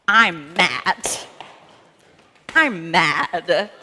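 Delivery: loud mock-angry I'm mad; mock mad stomp. Shown as a demonstration. Leader shows kids how to stomp anger out, mock-angry and loud. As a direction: stamp the words out like a cross kid. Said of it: "you can stomp it out. Say".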